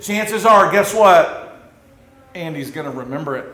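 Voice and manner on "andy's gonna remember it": quiet aside. Acted. Aside punchline.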